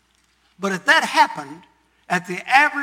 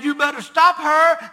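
urgent warning; 7 semitones higher, 7 dB louder than usual. Retold or imitated. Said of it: "and he said".

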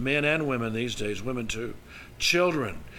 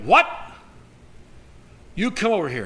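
sharp, high shocked "What?" then a stern order; mock-angry motherly outburst; outraged mother; 5 semitones higher, 5 dB louder, faster than usual. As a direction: imitate a scolding mom summoning her child. Mother reacts to child's bad word.

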